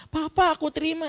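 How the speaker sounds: put-on very high, eager child's voice; excited child. Child telling Papa of being accepted, high and excited. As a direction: announce good news to Papa, pitched high.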